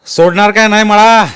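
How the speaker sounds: loud, high thundering demand; roaring question. A narrator's reading. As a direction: roar it down at him. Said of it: "ज्ञानूनं गरजून विचारलं". (गरजून विचारणे)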